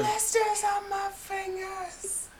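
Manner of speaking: slow, strained high mimicry; high, slow drawn-out quote. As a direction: stretch it high like the record. Echoing [name] scream.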